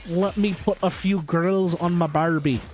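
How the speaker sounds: put-on clumsy blend of Russian and Australian accents. Attempting a viewer's accent mix, in mock Russian Australian. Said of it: "how to mesh those two".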